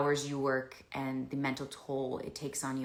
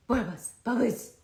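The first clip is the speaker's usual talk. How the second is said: whispered call; 7 semitones higher, 6 dB louder, slower than usual. Real, not acted. Calling her other dog.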